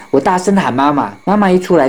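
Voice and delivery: ordinary delivery, nothing marked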